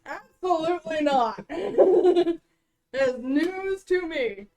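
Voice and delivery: chuckling through the words; laughing. Real, not acted. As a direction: laugh while answering. Laughing at the history check.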